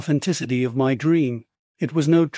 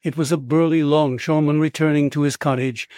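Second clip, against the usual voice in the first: usual speaking voice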